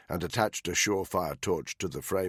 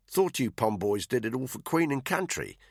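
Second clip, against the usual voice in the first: Aussie drawl; 7 semitones higher than usual. A narrator's reading. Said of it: "in her Aussie drawl".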